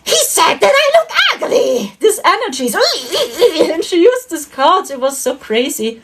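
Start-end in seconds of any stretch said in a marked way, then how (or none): 0.0-1.9 high, laughing mock of an angry [name]; [name]'s angry voice. Quoting dream [name] complaining about Trump, high mock voice. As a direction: pitch it up as a half-laughing upset [name]. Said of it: "Her voice was like".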